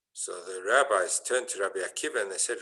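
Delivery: ordinary talk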